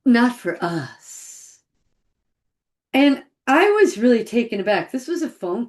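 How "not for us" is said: slow imitated reply, "us" stretched out long; "Not for us" with a weighty, lengthened "us"; stress on us. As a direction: lean on "us" and draw it right out. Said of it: "with the emphasis on the word us".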